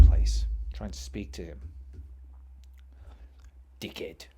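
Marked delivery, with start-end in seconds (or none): 3.8-4.3 low, near-whispered grumble; muttered insult. Real, not acted. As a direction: curse him under your breath. Grumbles about the old coworker.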